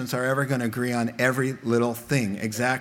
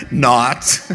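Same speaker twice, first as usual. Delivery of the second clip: sarcastic not; 3 semitones lower, 8 dB louder than usual. Acted. Sarcastic punchline after laugh.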